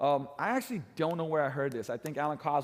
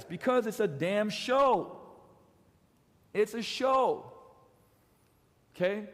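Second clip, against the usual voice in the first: fired up; 5 semitones higher, slower than usual. Real, not acted. Emphatic fired-up pitch.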